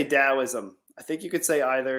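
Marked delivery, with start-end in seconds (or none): 0.0-0.8 Taoism said slowly with a D, "Dow-ism"; D pronunciation. Shown as a demonstration. Answering how they say it, Taoism with a D. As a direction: open Taoism on a D, not a T. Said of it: "I say Taoism".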